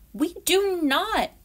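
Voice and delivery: put-on high, loud, slow kid's shout; a squirrel child shouting in a quarrel. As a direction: yell the denial back. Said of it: "Beck shouted".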